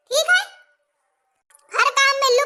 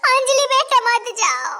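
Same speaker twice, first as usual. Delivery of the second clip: slow, drawn-out calling plea; wailing plea; slower than usual. Acted. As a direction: wail after your departing daughter. Mother calls after departing daughter. (खींचकर पुकारते हुए मिन्नत)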